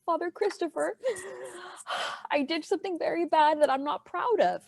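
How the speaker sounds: high, soft, put-on tearful voice; mock crying voice. Baker confesses to the priest, in a pretend crying voice. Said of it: "my crying voice".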